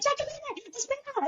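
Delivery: very high, thin mock pleading; insistent mom impression. Mom begging her to wait.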